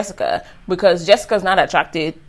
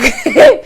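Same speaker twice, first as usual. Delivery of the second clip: nervous laugh; 7 dB louder than usual. Retold or imitated. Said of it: "Auntie was like".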